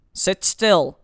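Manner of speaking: loud, curt command; authoritative officer's command; firm order. A narrator's reading. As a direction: order them sternly, raising your voice. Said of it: "the cop ordered".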